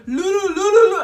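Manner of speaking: high, loud singsong babble; playful mock hooting; imitated uncle. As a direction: rattle it out comically high. Imitating uncle as chase happens.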